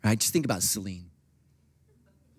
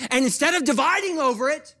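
high, raised preacher's voice; impassioned preaching; 13 semitones higher, 5 dB louder than usual. Real, not acted. Preacher urging church against dividing, impassioned and high. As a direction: lift the voice sharply to urge.

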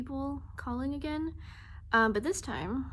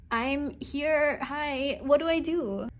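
usual speaking voice

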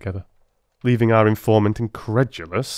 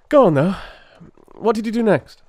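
louder, higher urging; pressing; 13 semitones higher, 6 dB louder than usual. A narrator's reading. Holmes presses the constable for more, eager and loud. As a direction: push him to go on, eagerly.

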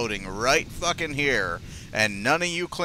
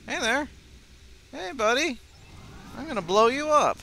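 high, sweet, fake friendly; sing-song taunt; 12 semitones higher than usual. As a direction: coo at the target, then threaten.

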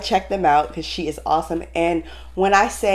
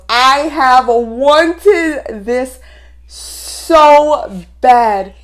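very slow, loud "wanted this so bad"; emphatic longing; 4 semitones higher, 6 dB louder, much slower than usual. Real, not acted. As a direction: pause before "so bad" and land it hard. Stresses how much she wanted it.

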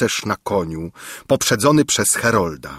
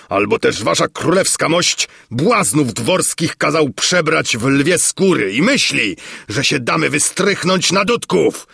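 raised, high voice of protest; indignant shouting; 6 semitones higher than usual. A narrator's reading. Spectator accusing the king of trickery, indignant shouting. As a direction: argue hotly that it's a fraud. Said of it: "krzyczał, wymachując pięścią".

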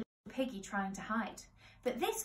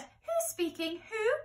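higher, ladylike telephone voice; 7 semitones higher, 4 dB louder than usual. Acted. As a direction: chat sociably down the line.

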